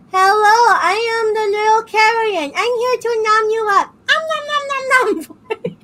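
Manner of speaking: high, squeaky cute anime voice; sweet, tiny monster nomming; high cute anime girl. Retold or imitated. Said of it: "doing this cute anime voice".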